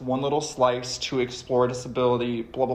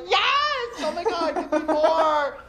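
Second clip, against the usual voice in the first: gushing fans. Retold or imitated. Mocking Woody Allen fans, laughing over-the-top gushing. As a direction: gush like an adoring fan. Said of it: "people are like".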